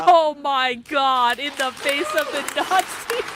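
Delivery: can't hold back the laughter; incredulous laughing repetition; laughing disbelief. Real, not acted. Host laughing at council speaker.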